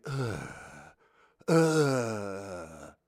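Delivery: "Ugh" for arr, with no R at all; a southeast English pirate grunting "ugh"; mock pirate groan without the R. Shown as a demonstration. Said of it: "They would say".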